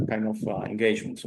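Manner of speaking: usual speaking voice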